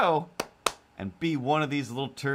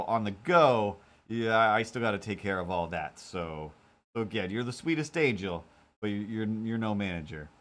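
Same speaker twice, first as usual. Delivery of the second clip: normal talking voice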